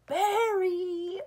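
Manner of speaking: high, loud drawn-out call; calling the dog. Retold or imitated. Babysitter calling the dog in at the back door, loud call. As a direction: holler the name out into the yard. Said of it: "calling this dog's name".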